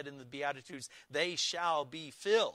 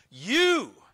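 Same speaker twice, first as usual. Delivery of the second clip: "You" shouted out much louder and higher, as if ending in an exclamation point; emphatic you; 8 semitones higher, 14 dB louder than usual. Shown as a demonstration. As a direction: punch the word "You" hard and high. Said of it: "putting an exclamation point after the "you".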